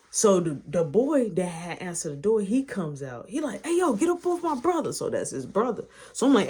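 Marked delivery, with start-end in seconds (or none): 3.6-4.9 high, quick mock challenge; retold protective outburst; confrontational. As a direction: imitate a brother jumping into a fight. Acted quarrel, brother confronting.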